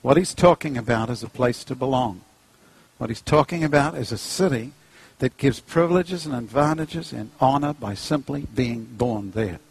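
normal talking voice

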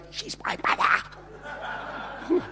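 slow, urgent stage whisper. Retold or imitated. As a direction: hiss it as an exaggerated stage whisper. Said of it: "in a stage sort of a kind of a".

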